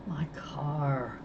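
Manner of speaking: hushed broken whisper. Acted. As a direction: breathe the words, crushed. Eyes closed, heartbroken.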